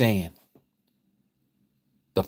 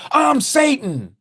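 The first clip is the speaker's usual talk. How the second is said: much higher, slow, hammed-up Satan; mock Satan announcing; 13 semitones higher, 4 dB louder, slower than usual. Preacher mocking the idea of Satan announcing himself, high and hammy. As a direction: play a cartoon devil openly naming himself. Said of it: "you think the Antichrist is gonna come and just say".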